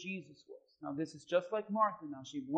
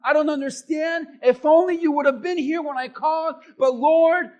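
loud, raised, impassioned pulpit shout; full-force preaching; 8 semitones higher, 13 dB louder than usual. Real, not acted. Preacher urging people to be real with God, shouted preaching. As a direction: cry out the complaints to God at full power.

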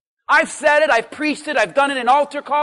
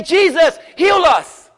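loud plea; 2 semitones higher, 5 dB louder, slower than usual. Retold or imitated. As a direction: shout the plea as the men might. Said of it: "They didn't say".

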